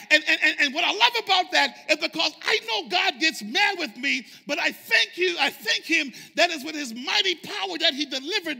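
high-pitched, impassioned sermon climax; full-force preaching. Acted. Preacher thanking God for delivering the congregation, full-force preaching. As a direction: build to a loud punched finish.

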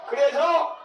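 high, loud, drawn-out Jeolla dialect shout; Jeolla grandma dialect. Retold or imitated. Grandma winning the color contest, triumphant Jeolla dialect shout. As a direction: crow it out in Jeolla dialect, laughing. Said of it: "세 번째".

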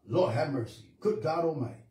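ordinary talk, nothing marked

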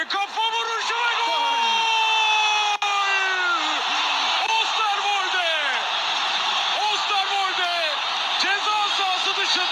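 high shouted goal call; screaming football commentator. Real, not acted. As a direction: scream the scorer's name. Goal scored, commentator erupts.

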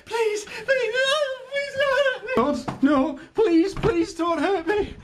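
very high, crying plea; terrified pleading. Acted. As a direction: shriek and beg for mercy. Begging for mercy.

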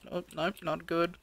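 normal talking voice

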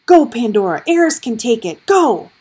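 loud, urgent command; commanding order. A narrator's reading. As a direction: bark the order. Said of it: "Gregor heard Meredith order".

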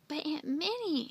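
high, pleading objection; exclaimed protest. A narrator's reading. Andy objecting to his aunt's refusal, exclaimed protest. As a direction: exclaim in dismay. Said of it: "Andy exclaimed".

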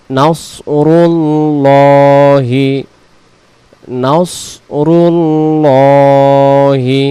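slow, drawn-out melodic tilawat; Quran recitation. Shown as a demonstration. Teacher reciting the verse slowly for students, melodic chant. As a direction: chant each word long and steady.

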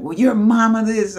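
high, loud schoolyard jeer; mock taunt. Acted playground taunt.

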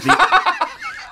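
high silly giggle; goofy high laugh. Acted. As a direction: giggle like a fool.

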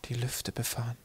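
hushed, very soft voice; softly breathed daydream; whispered longing. A narrator's reading. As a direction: whisper it to yourself in awe. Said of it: "flüsterte er und sein Herz pochte".

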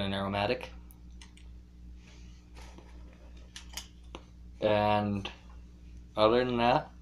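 clenched mouth speech; talking with pipe in mouth. Real, not acted. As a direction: pipe stem between teeth. Seen 3: Pipe stem clenched in mouth; briefly removed around 2.9–4.6s.

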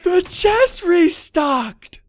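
gasp, then high, loud, stretched-out cheer; ecstatic shout. Real, not acted. Player discovering the chest restocked, ecstatic shout. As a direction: cry out in astonished triumph.

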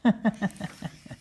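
high mocking laugh; turkey cackle. Turkeys laughing at the fallen pilgrims in high cackle. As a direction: cackle at them.

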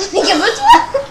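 loud, high burst through laughter; real amused outcry; startled, laughing exclamation. Real, not acted. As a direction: exclaim it, half laughing at the scream. Reacting to a sudden scream.

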